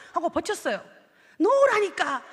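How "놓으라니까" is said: insisting. Retold or imitated. God insists.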